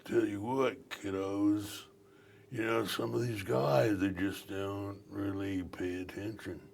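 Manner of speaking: easy like a mellow beach uncle; a hint of an old surfer dude talking to kids. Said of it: "Surfer dude, he goes".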